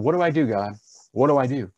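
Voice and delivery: high pleading cry to God. Retold or imitated. Preacher retelling his jail plea, crying out to God. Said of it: "That's crying out to God".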